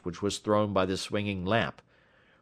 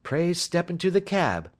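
higher-pitched, lively Holmes explaining his trick; self-assured detective; 10 semitones higher than usual. A narrator's reading. Detective explaining the bet trick to his companion, self-assured. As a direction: speak as the confident sleuth. Holmes invites Ryder along.

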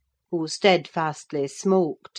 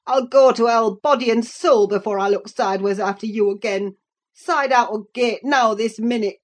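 loud, raised, angry dialect; furious rustic Hareton; 5 semitones higher, 6 dB louder than usual. A narrator's reading. Cousin orders Catherine out of his way, loud and angry. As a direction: swear her off and order her out.

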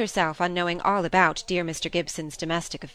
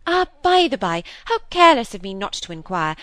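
sudden recollection; 3 semitones higher, 6 dB louder than usual. Acted. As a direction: exclaim as you remember a forgotten courtesy. Sister suddenly remembers to inquire.

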